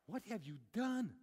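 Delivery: loud, high, frightened demand; alarmed demand. Acted. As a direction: hurl the question at him in panic. Terrified sailors confront Jonah.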